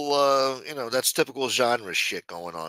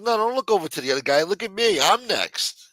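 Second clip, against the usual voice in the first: indignant protest; 7 semitones higher, 3 dB louder than usual. Retold or imitated. Retold protest at cashier's line.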